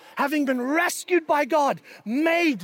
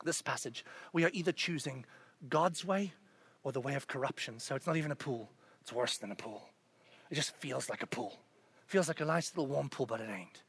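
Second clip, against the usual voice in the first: much softer and lower; low intensity; 9 semitones lower, 13 dB quieter than usual. Real, not acted. Preacher sinking into a much softer, lower voice. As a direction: draw them in with a near whisper.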